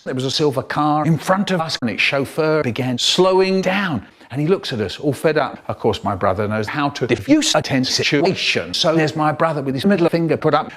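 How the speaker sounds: usual speaking voice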